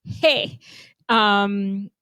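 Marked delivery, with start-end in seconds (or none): none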